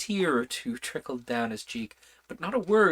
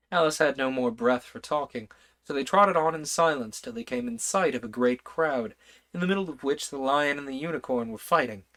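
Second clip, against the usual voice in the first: ordinary delivery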